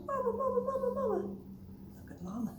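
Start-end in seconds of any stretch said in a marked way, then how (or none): 0.1-1.2 put-on very high-pitched baby duckling chatter; ducklings calling. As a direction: squeal it like ducklings after their mother. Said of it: "they're all going".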